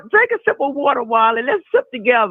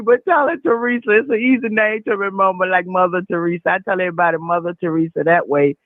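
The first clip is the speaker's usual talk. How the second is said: ordinary talk, nothing marked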